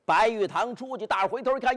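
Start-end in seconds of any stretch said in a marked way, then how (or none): none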